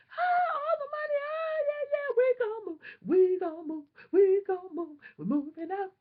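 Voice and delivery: very high, loud, tearful cries of joy; overjoyed. Retold or imitated. Hearing they'll pay all the money, very high joyful crying. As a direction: scream it like you can't believe the news. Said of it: "I was just so happy".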